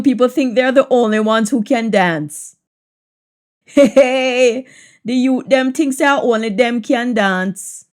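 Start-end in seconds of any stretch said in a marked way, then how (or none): 3.7-7.9 teasing Jamaican patois. Shown as a demonstration. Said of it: "patois version of the line".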